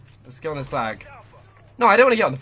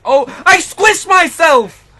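high drawn-out wail of dismay; anguished shout; 9 semitones higher, 9 dB louder, much slower than usual. Real, not acted. Gamer killed by his own mistake, wailing in dismay. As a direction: howl at your own blunder.

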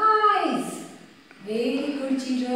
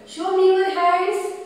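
louder and higher to the class; calling out; 4 semitones higher, 5 dB louder than usual. Real, not acted. Teacher calls on her pupils to raise hands, loud.

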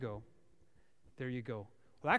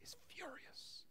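whispered; 10 semitones higher, 21 dB quieter than usual. Acted. Dramatic hushed aside.

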